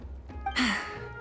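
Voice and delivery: soft exhale before thanks; quiet breath out, easing off; sigh. Acted. Leader thanking two helpers who will join, soft sigh. Grateful for offered help.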